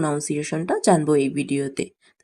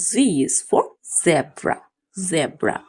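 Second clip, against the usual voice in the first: slow drill; much slower than usual. Shown as a demonstration. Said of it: "slow teaching drill".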